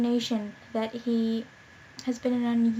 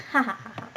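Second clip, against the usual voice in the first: flat read laugh; faster than usual. Acted. Reads laugh text.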